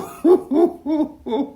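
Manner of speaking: real high-pitched cackling laugh with an exclamation; high laughter. Real, not acted. Commentator laughing at a celebrity's crying quote, high cackle.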